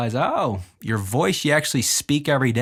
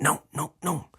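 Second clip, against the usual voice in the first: short, higher, softer ad-lib noes; light, high little interjections; ad-lib demo; 6 semitones higher, 6 dB quieter than usual. Shown as a demonstration. Showing listeners the song's ad-libs, softly pitched up. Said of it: "some ad libs where I'm like".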